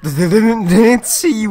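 loud, high disbelief; surprised shout. Real, not acted.

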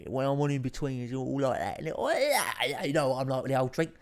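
Essex accent. Shown as a demonstration. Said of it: "dialed down the Essex accent".

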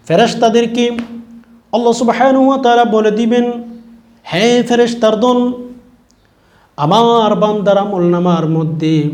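high, loud proclamation, settling at the end; impassioned preaching. Acted. Preacher voicing Allah ordering the angels, impassioned preaching. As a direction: ring out a divine order.

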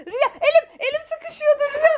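high, laughing shock after a scare; startled laughing. Real, not acted. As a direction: gasp and laugh at the surprise. Startled by found child, laughing.

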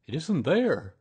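high, loud announcement; emphatic bear. A narrator's reading. Bear telling donkey his tail is gone, emphatically. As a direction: declare it firmly in a character voice. Pooh reports missing tail.

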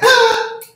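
sharp high gasp of a startled man; mock shocked gasp. Men hearing the pastor, high mock gasp. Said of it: "some of them dudes is like".

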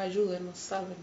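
very soft echo of distant screams; retold cries for help. Recalling trapped people calling from the hills, hushed retold plea. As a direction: voice their pleas softly, without shouting. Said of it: "como la gente gritaba".

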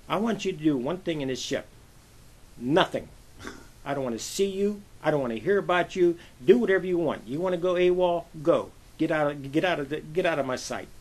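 hammed-up scolding, building in force; retold dressing-down. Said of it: "went up one side, down the other side".

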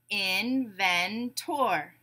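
"Inventor" very slowly, in-ven-tor with a full "tor"; syllable by syllable. Shown as a demonstration. Teacher breaking a word into syllables, beat by beat. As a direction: separate the three beats of "Inventor". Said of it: "we'll break it into syllables".

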